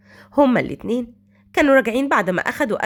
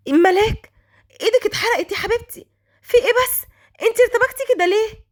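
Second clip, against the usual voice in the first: high, worried exclamation; alarmed concern; 10 semitones higher than usual. A narrator's reading. Girl sees the maid's hand burnt by tea, alarmed. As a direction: raise the pitch right up in startled concern.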